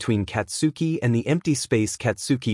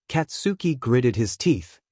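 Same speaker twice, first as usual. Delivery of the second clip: ordinary delivery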